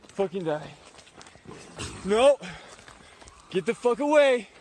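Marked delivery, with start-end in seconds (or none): 2.0-4.5 shouting. Real, not acted. Shouting at attacking animal.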